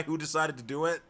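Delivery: ordinary talk, nothing marked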